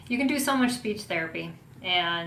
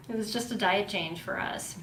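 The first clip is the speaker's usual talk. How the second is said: ordinary talk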